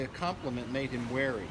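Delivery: usual speaking voice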